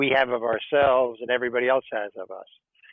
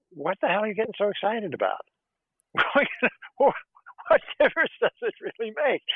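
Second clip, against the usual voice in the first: high, amused inner voice with a laugh; little inner voice; 8 semitones higher, faster than usual. Acted. Inner voice nags an attorney mid-battle, high and amused. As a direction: pipe up as a teasing little voice, much higher. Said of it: "the little voice saying to you".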